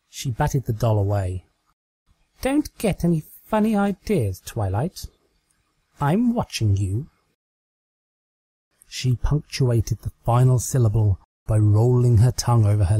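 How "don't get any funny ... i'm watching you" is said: higher, playful mock warning; teasing Trixie. Trixie warning her Twilight doll, higher and teasingly. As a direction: wag a finger at her in jest.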